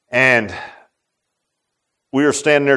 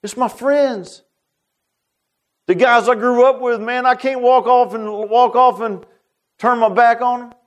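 higher, strained voice close to tears; welling up; 8 semitones higher than usual. Real, not acted. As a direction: let the emotion choke the words. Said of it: "I was just welling up inside".

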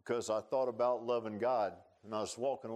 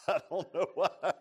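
high, loud laughing voice; laughing at own tearfulness; 9 semitones higher, 6 dB louder than usual. Real, not acted. Preacher admits everything makes him wanna cry, laughing. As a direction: laugh helplessly through the words. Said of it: "causes me wanna cry".

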